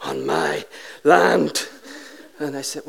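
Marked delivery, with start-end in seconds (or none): 0.0-1.7 slow, menacing low growl of a landowner; low mock threat with heavy pauses; pumped with anger. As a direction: imitate a furious man, word by word. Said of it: "He is pumped with anger".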